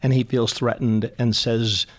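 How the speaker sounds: ordinary delivery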